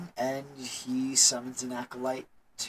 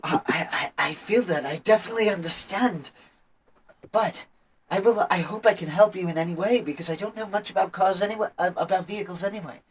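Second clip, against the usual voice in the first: politely flustered, pitched up and stumbling over words; uncomfortable young acolyte; 5 semitones higher, faster than usual. Acted. Acolyte tugged along by car enthusiast, high and flustered.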